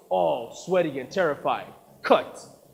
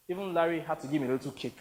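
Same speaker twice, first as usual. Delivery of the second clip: usual speaking voice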